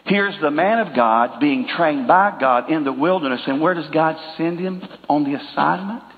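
normal talking voice

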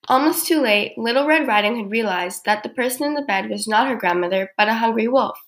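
plain reading voice

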